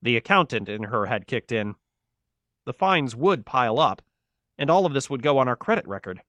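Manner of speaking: ordinary delivery, nothing marked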